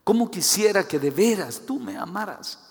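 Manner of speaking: insistent, heartfelt appeal; earnest plea. Acted. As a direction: lift the voice and plead for love. God's plea repeated with more force.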